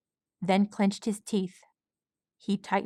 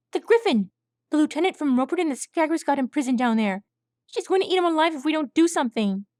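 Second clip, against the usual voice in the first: put-on high, loud and rushed young colt; urgent colt; 7 semitones higher, 4 dB louder, faster than usual. A narrator's reading. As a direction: spill it all out fast in a panic. Swab urging, rushing.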